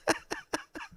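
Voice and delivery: very high, soft laugh; helpless little high giggle. Real, not acted. Host laughing at himself mid-show, soft high giggle.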